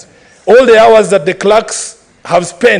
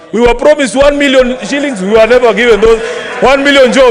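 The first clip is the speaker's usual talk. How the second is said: heated shout; 6 semitones higher than usual. Real, not acted. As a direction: hurl the broken promises back at them. Heckling rival coalition over broken promises.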